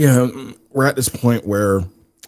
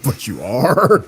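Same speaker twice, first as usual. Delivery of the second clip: laughing; 6 semitones higher, 5 dB louder, slower than usual. Real, not acted. Laughing at host eating.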